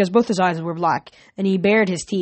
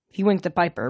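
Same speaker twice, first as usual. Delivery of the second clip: ordinary delivery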